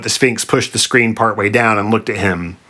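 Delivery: ordinary delivery, nothing marked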